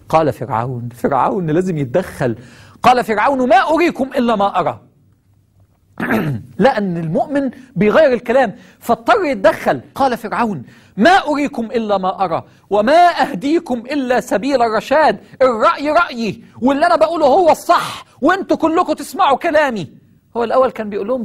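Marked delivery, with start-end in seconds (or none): 3.5-4.8 arrogant Pharaoh. Acted. 11.0-19.9 arrogant Pharaoh. Acted.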